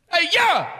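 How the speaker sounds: high-pitched put-on aggressive shout; aggressive scream. Shown as a demonstration. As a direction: scream it out to get a yell back. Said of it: "scream in your best aggressive".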